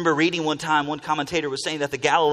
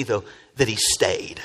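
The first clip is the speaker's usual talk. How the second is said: ordinary delivery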